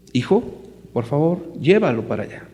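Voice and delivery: a father asking his son evenly, lightly voiced; polite father's request. Father asking his little son to carry something, plain polite request. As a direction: ask the boy kindly to carry it over. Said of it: "yo como papá, yo quiero pedirle a mi hijo".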